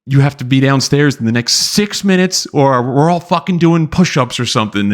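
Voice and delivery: a hint of a barking drill instructor; mild drill instructor. Drill instructor lightly voiced ordering recruits downstairs.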